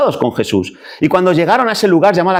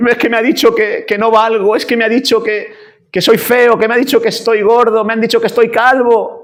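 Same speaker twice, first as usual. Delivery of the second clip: high-pitched, whiny self-pity; hammed-up wounded complaint; mock crying; 7 semitones higher than usual. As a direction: whimper theatrically about petty insults. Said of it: "Si yo lloro porque".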